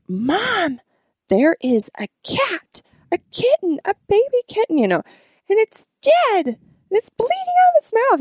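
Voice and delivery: put-on high, urgent little-girl report; high kid voice telling mom about a dead kitten; daughter's voice. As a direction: voice the daughter rushing back with news.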